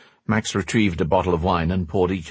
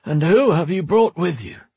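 put-on old man voice; 10 semitones higher, 3 dB louder than usual. A narrator's reading. Old mage Max speaking.

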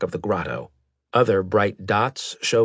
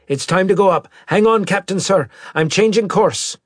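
loud, high-pitched warning to the captain; urgent pilot call; 11 semitones higher, 6 dB louder than usual. Acted. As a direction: call out over the emergency. Pilot calls warning during emergency.

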